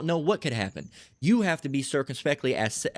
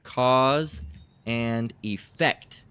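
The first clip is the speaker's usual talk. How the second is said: "Cause and effect" stretched out, with a pause after "Cause"; slow dictation; much slower than usual. Shown as a demonstration. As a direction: dictate "Cause and effect" slowly for someone looking it up. Term dictated for looking up.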